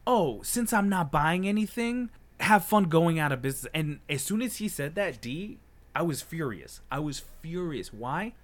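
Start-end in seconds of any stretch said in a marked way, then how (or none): 0.1-3.7 loud, high mocking imitation of a customer; condescending. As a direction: sneer it loudly, mimicking his condescension. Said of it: "passive-aggressive, condescending way".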